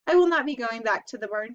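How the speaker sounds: usual speaking voice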